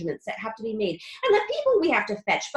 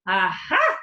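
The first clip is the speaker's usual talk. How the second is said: gleeful aha; 4 semitones lower, 6 dB louder than usual. A narrator's reading. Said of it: "cried Mister Wonka".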